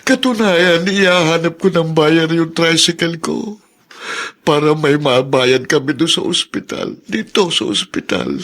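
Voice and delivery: high, near-crying plea; anguished, pleading cry; desperate distress. Acted. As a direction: break under the weight of the bills. Poor husband despairs over hospital bills.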